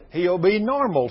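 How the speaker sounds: normal talking voice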